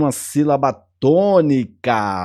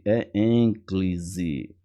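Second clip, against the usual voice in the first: "é ênclise" said slowly and softly, stress on é; slow emphatic correction; 8 semitones lower, 9 dB quieter, much slower than usual. Shown as a demonstration. Teacher correcting próclise to ênclise, slow and firm. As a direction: lean on "é" and stretch "ênclise" softly. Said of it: "não é próclise".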